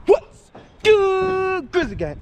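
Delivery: upbeat vlogger hello; high greeting shout-out. Acted. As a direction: sing out the intro greeting to fans. Vlogger's signature greeting opener.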